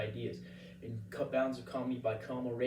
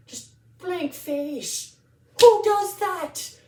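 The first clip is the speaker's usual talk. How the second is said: very high, loud comic shriek; incredulous outburst; 15 dB louder, much slower than usual. Acted. Comedian on the lawyer's blank face, incredulous high outburst. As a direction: shriek each word slowly in exaggerated disbelief.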